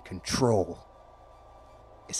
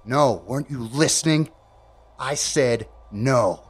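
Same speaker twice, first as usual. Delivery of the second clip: irritated; 3 semitones higher, 8 dB louder than usual. Real, not acted. Irritated repeat.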